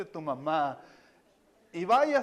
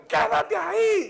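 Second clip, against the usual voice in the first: orden gritada, aguda y alargada; furiosa; 13 semitones higher, 7 dB louder, slower than usual. Retold or imitated. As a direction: imita a la esposa furiosa gritando una orden. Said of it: "Sentí que la furia de Dios entró". (grito furioso actuado)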